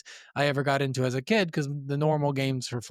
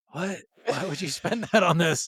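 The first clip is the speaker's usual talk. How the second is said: laughing disbelief; 3 semitones higher than usual. Retold or imitated. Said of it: "And I'd be like".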